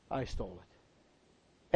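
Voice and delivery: very low, slow and soft; reluctant confession. Retold or imitated. Son finally admits the theft to dad, reluctant confession. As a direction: own up quietly, dragging the words out.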